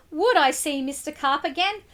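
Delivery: plain reading voice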